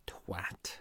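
under-the-breath whisper; whispered insult. Real, not acted. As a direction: breathe the insult out, barely voiced. Muttered insult after tattoo detail.

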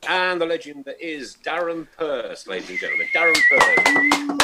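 drawn-out boxing ring announcer; grand entrance announcement. Acted. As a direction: stretch the name out like a ring announcer. Host hails star guest.

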